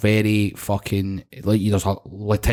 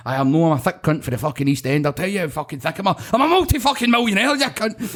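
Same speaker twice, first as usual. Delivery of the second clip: angry rant; 6 semitones higher than usual. Retold or imitated. Retold roofer raging at lawyer.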